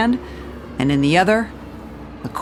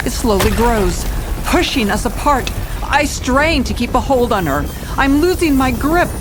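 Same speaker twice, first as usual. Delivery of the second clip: higher, louder urgent narration; tense climax narration; 6 semitones higher, 4 dB louder than usual. Acted. Detective narrates the collapse in a raised urgent voice. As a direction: tell it like the floor is splitting.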